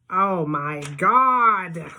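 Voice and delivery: loud, long drawn-out exclamation; big, loud, drawn-out whoop; whooping shriek. A narrator's reading. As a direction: whoop it up in amazement, stretching each word. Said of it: "he's shrieking and laughing and whooping it up".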